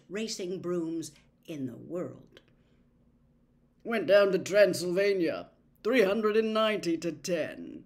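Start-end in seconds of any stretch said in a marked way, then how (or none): 3.8-7.8 a touch of gloom, louder; gloomy. A narrator's reading. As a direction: grumble over the lost match. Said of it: "said Charlie gloomily".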